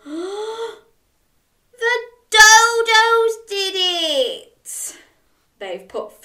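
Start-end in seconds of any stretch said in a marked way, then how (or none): 0.0-5.0 high, loud, very slow, almost sung; sing-song refrain. A narrator's reading. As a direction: draw out the refrain melodically. Climax of the refrain.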